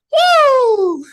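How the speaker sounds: high, loud, drawn-out "woo"; imitated war cry. Colleague mimics a war cry heard going home with students, loud whooping. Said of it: "then I hear a war cry".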